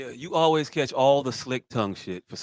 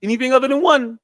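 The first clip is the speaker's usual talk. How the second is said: high, louder mock pronouncement; mock Terrence Howard claim; 12 semitones higher, 9 dB louder than usual. Ribbing the actor's math theory, high mock pronouncement. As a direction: parody him stating it as gospel.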